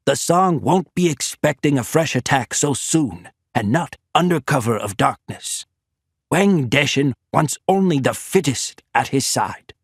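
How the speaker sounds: brief the officers like a commander readying an attack; military commander giving orders; General Koke. A narrator's reading.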